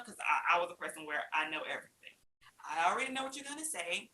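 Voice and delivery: ordinary talk, nothing marked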